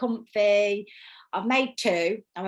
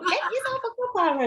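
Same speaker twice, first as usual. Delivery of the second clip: put-on laughing, much higher try at a Scottish accent; 13 semitones higher, 3 dB louder than usual. Trying the vlogger's catchphrase again in a laughing Scottish accent. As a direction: have another go at the Scottish line. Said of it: "I can't do a Scottish accent".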